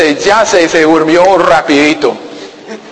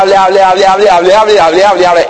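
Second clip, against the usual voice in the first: mock chatter; 2 semitones higher, faster than usual. Pastor mimicking the chatty seatmate, breathless babble. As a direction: rattle it off like a nonstop talker.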